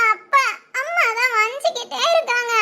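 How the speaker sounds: high, slow tearful complaint; tearful child. Acted. Child complaining to father about mother's scolding, tearful. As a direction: whine to Daddy through tears. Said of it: "அழாத".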